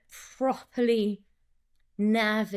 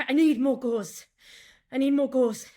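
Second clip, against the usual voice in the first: breathless gasping delivery; panting through the line; out of breath; 3 semitones higher, 4 dB louder than usual. Shown as a demonstration. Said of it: "I'm out of breath".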